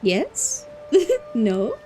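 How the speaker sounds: normal talking voice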